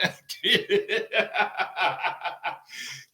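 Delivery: real helpless giggle; long run of chuckles; laughing. Real, not acted. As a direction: crack up at your own joke. Cracks up at own joke.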